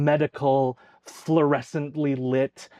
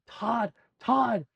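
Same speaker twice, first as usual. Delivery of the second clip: high, restrained distant call; thin, raised-pitch call, not shouted; 9 semitones higher than usual. Acted. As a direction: call a friend's name from far down a hall. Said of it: "hear in the distance".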